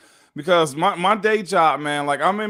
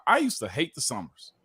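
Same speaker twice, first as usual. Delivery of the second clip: ordinary talk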